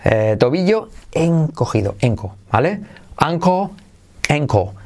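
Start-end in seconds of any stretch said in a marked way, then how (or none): none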